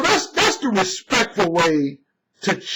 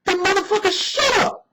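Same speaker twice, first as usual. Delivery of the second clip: high, dismissive mock shout; shutting down a critic who hadn't read the book, high and mocking; retold put-down; 12 semitones higher than usual. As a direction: squeal it out in a much higher put-down voice. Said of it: "I was like".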